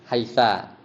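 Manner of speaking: haisa with a final a; a ending. Shown as a demonstration. Teacher showing another dialect's reading, haisa ending in a. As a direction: end haisa on an open a. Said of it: "sebagian dialek bacanya".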